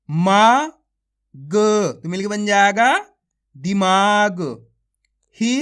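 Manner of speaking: stretched-out syllable calling, each syllable punched; phonics drill. Shown as a demonstration. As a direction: sound out each syllable slowly for children, then join them. Sounding out syllables into words.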